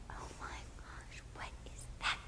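hushed, stunned whisper; shocked whisper. Retold or imitated. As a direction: whisper it so no one hears. Whispering at the nativity.

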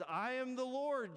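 lightly acted divine proclamation; voice of God. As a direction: announce yourself grandly, drawing it out. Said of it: "And the voice says".

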